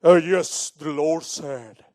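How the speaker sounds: loud then slow, grand preacher voice; mock Dale's weighty pulpit tone; imitating Dale. Pastor teasing his father-in-law Dale with a slow solemn preacher voice. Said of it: "he's like".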